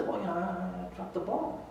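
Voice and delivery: hushed, halting admission; imitating an embarrassed player, very soft; sheepish. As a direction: own up meekly, almost under your breath. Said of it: "a little sheepishly and he goes".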